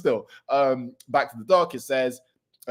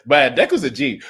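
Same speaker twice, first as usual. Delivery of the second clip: ordinary talk, nothing marked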